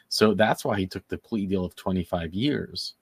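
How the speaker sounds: usual speaking voice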